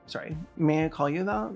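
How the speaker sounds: normal talking voice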